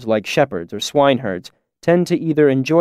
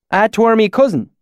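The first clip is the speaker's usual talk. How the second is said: higher, louder fake backwoods dialect; put-on valley accent; 10 semitones higher, 4 dB louder than usual. Narrator poses as a local, put-on valley accent. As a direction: narrator fakes a rural accent to fit in. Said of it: "He looked taken aback when he heard me speak".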